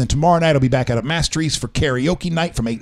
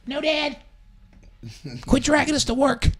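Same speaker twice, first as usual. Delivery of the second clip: put-on kids' voice; 12 semitones higher, 3 dB quieter than usual. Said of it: "Joey, Roxy, do you guys have anything".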